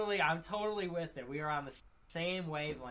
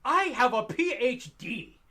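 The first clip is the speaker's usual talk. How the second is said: high, loud, slow mock indignation; indignant snob; 8 semitones higher, 10 dB louder than usual. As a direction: spell it out, deeply offended. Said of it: "like, indignant".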